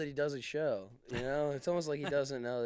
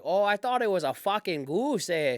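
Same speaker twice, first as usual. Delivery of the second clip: mock Canadian accent, pitched up; 5 semitones higher, 5 dB louder than usual. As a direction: play a backwoods Canadian shooting at geese. Canadian guy impression, eh.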